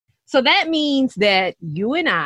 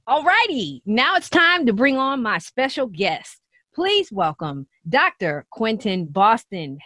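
ordinary talk

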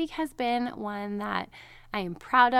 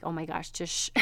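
mock irritated hush; 4 semitones lower, 6 dB quieter than usual. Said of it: "annoyed that he's even breathing".

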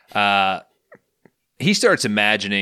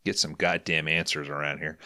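normal talking voice

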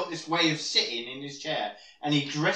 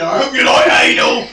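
loud, higher blurted punchline; sudden shout; 8 semitones higher, 13 dB louder than usual. Retold or imitated. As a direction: blurt it out at full volume. Punchline after nervous introduction.

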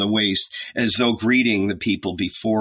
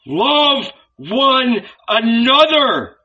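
slow, much higher, loud cry on LOVE; cry from the gut; 13 semitones higher, 5 dB louder, much slower than usual. A narrator's reading. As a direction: tear it out of yourself, drawn out. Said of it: "The cry escaped from his very bowels".

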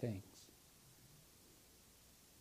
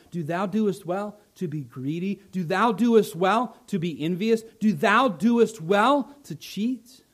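God's questions building; 4 dB louder than usual. Acted. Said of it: "God says".

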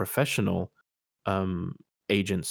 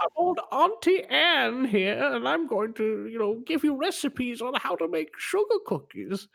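put-on high frail old lady voice. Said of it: "she speaks in a very high, frail voice".

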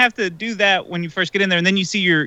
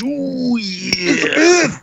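higher, drawn-out wrestler catchphrase; Macho Man Randy Savage; 6 semitones higher than usual. Acted. Fan names his favorite wrestler, imitating the catchphrase. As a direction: stretch it out in a put-on wrestler voice. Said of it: "You gonna give a Macho Man promo".

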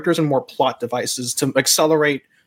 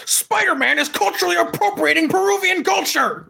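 high, far-off blustering editor voice; barking newspaper editor. Retold or imitated. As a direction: bark it like a hammy tabloid boss. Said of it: "Jonah Jameson one day he goes".

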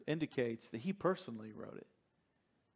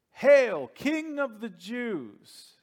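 loud, higher, slow mocking salute; mocking hail; 9 semitones higher, 9 dB louder, much slower than usual. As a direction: sneer it out like a jeering soldier. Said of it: "This is what the Roman guards were calling him".

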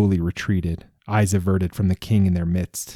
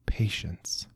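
breathy whisper to oneself; whispered patience; 11 dB quieter than usual. A narrator's reading. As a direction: hush it, a private reminder. King's inner urge to himself.

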